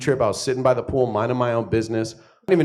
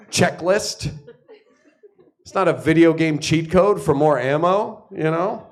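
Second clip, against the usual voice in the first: mock-indignant passion speech; 7 semitones higher than usual. Defensive speech about passion.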